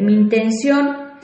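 usual speaking voice

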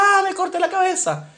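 put-on high-pitched child's cry for mum; mock panicked wail. Child comes home to mother with cut head, wailing. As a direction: replay the alarm, hammed-up.

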